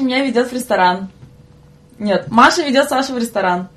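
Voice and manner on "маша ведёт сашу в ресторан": ordinary talk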